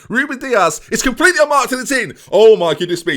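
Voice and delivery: high, loud goal celebration; excited goal shout. Real, not acted. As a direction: yell it like the ball just hit the net. Goal scored, commentating shout.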